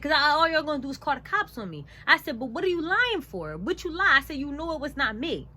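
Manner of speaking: loud, high accusing voice; indignant confrontation. Retold or imitated. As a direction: confront the liar in a heated retelling.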